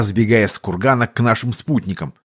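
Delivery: ordinary delivery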